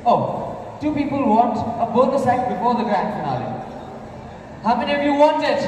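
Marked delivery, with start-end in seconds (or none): none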